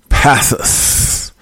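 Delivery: breathy whispered "Passus"; secretive whisper of "Passus"; whispered name. Shown as a demonstration. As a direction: hush it out, voiceless "Passus". Whispered name, then said normally.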